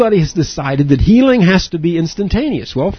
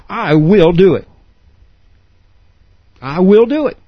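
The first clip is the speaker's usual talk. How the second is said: a determined "I will do it"; emphatic declaration; 3 semitones higher than usual. Shown as a demonstration. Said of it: "That's your showing emphatically".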